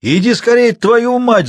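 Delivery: put-on high, loud squealing old man; angry, squealing yell; spiteful shrill shouting. A narrator's reading. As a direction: screech a furious demand. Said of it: "злобно, визгливо кричал старик".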